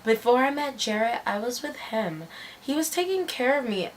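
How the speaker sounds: quiet imitated confession; imitating a friend. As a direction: retell her answer softly, almost confiding. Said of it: "And she was like".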